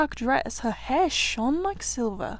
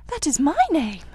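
crying out; 5 semitones higher, 4 dB louder than usual. A narrator's reading. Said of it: "cried the princess".

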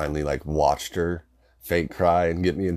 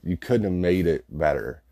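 ordinary talk, nothing marked

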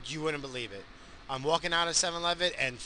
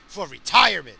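loud emphatic repeat; 11 dB louder than usual. Real, not acted.

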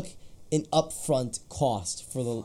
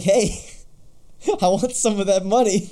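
louder, higher, stretched-out mock demand; mock indignant demand; 8 semitones higher, 6 dB louder than usual. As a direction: act out an affronted author wanting his cut. Acting out the author demanding money.

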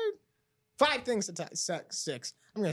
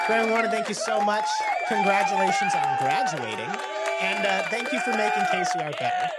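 loud, warm thank-you call; 5 semitones higher, 6 dB louder than usual. Real, not acted. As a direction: call out thanks across the room. Loudly thanking the departing guest.

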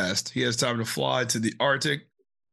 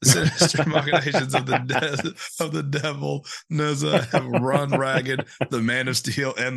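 dramatic announcer; 3 semitones higher than usual. Shown as a demonstration. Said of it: "Look at that. I can read".

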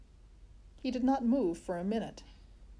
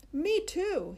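put-on high, eager boy voice; excited boy calling out; 10 semitones higher, 4 dB louder than usual. A narrator's reading. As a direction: brag about the biscuits. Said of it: "he called".

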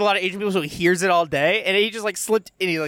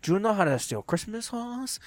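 softer mock Mexican accent; 2 semitones higher, 7 dB quieter than usual. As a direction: ask softly in a put-on Mexican accent. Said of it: "my Mexican voice on".

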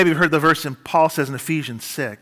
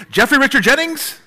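a hint of a scolding mother, pitched higher; mother calling full name; 8 semitones higher, 3 dB louder than usual. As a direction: call out the full name like a mom in trouble-tone. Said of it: "with that tone of voice".

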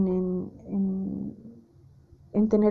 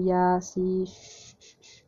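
mother hushing; 2 semitones lower, much slower than usual. Retold or imitated. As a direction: gently shush a crying little one. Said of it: "su mamá le dice".

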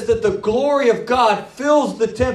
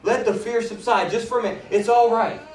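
reassuring God; 4 semitones lower, 4 dB quieter than usual. Acted. God calming a terrified man.

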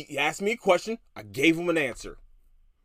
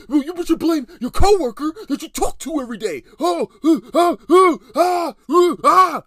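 much higher, much louder whiny sputter; mocking tantrum; 13 semitones higher, 13 dB louder than usual. Mocking the coworker throwing a fit, high and loud. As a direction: parody a grown man's hissy fit. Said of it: "that's literally what he's doing".

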